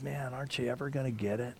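soft, exasperated plea after a deep sigh; weary sigh. Retold or imitated. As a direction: let the question sag with disappointment. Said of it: "And he sighs deeply".

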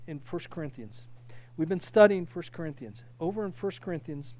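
normal talking voice

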